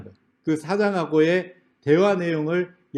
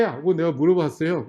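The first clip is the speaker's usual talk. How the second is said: normal talking voice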